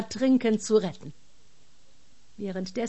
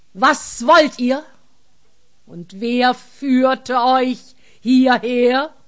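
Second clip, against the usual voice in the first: booming giant voice; 3 semitones higher, 9 dB louder, slower than usual. Acted. Giant confronts the soldiers.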